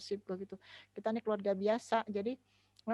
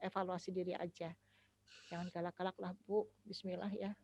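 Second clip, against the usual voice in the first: ordinary delivery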